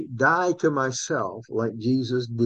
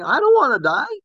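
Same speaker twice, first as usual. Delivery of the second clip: high, loudish mock protest; acted-out reluctant cry; flesh pleading; 13 semitones higher, 4 dB louder than usual. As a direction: plead against dying, pitch way up. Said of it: "that's the flesh saying".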